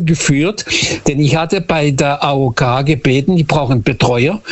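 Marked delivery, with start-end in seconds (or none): none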